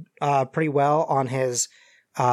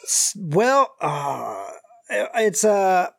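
hesitant folksy Southerner, drawn out; stall, then deliver it with Southern colour; injured Southern stranger; 2 semitones higher, slower than usual. Acted. GM voices the injured man.

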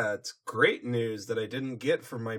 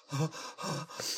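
dramatic gasp; 2 semitones higher, 7 dB quieter than usual. Acted. Rarity overwhelmed.

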